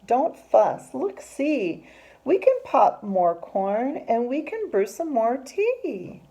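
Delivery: high, loud, perky little mouse voice; squeaky mouse. Acted. Mouse offers bear popcorn and tea, squeaky reassurance. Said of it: "Mouse squeaks".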